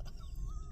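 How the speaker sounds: high squealing laugh; shrieking disbelieving laugh; burst of laughter. Real, not acted. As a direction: crack up in disbelief. Reacting to prophet's $444 ask.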